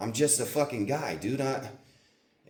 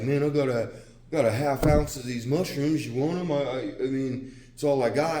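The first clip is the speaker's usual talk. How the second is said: higher, louder, hammed-up voice of the guy; pushy guy pitched up and loud; imitating the mushroom guy; 5 semitones higher, 5 dB louder than usual. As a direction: play the guy who's short on cash. Guy offering mushrooms as payment.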